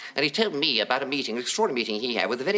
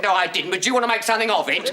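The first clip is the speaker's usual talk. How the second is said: louder, higher, fast laughing retort; mock belligerent conductor; cheeky challenge; 10 semitones higher, 6 dB louder, faster than usual. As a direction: pick a fight, half laughing. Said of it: "the conductor said".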